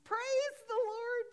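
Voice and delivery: high, loud, slow cry of praise; joyful yell. Retold or imitated. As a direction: call it out long and high like an old lady. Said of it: "yelled the lady".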